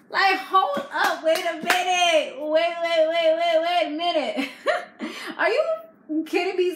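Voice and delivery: high, loud, slow and laughing in disbelief; can't believe what you're watching, laughing through it; stunned disbelief. Real, not acted. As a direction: hold up, half laughing, half shocked. Own shocked reaction to fight.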